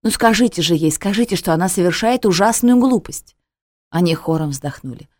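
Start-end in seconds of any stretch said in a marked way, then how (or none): 0.0-3.4 raised, heated plea; louder and higher, in a fury; furious. A narrator's reading. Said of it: "Я в ярости обернулась".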